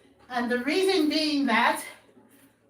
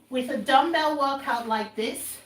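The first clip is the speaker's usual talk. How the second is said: normal talking voice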